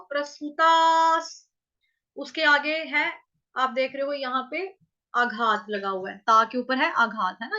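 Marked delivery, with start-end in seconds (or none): 0.0-1.5 प्रसृतास stretched with a long ता; slow demo. Shown as a demonstration. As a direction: draw out the ता in प्रसृतास. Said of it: "तो ता".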